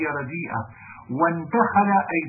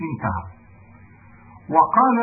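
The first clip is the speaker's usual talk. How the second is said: ordinary delivery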